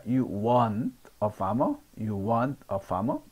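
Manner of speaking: "want" said for "aren't not", slow with a w-sound; reduced aren't. Shown as a demonstration. Teacher shows how aren't sounds, slow and clear. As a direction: say "want" slowly, as the shortened aren't. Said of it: "원낫을 줄이면 want가 됩니다".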